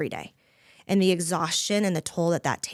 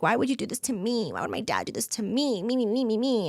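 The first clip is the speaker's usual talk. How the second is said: higher, whiny mock of her younger self; mock self-pity; 4 semitones higher than usual. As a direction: ham up a self-pitying whine. Mocking her own past self-pity.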